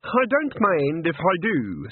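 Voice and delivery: high, slow, drawn-out acceptance; Granny character voice. A narrator's reading.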